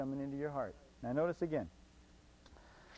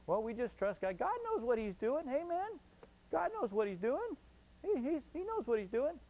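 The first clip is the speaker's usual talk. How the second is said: mock pious, pitched up and singsong; sarcastic; 7 semitones higher than usual. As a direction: fake cheerful piety, high and lilting. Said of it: "I'm being facetious right now".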